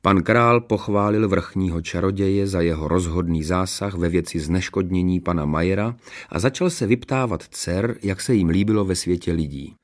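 usual speaking voice

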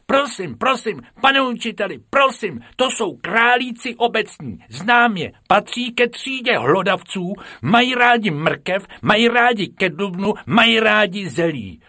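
high, loud know-it-all pupil; eager know-it-all girl. Acted. Top pupil Krupičková reciting rabbit facts to the teacher. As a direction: rattle off facts like the top student.

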